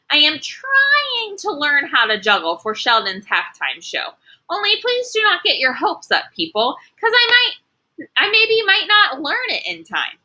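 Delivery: put-on high, pleading little girl voice; nervous confession. Junie B confesses to the class, high and pleading.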